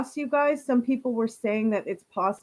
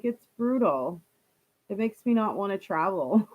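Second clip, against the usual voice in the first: ordinary delivery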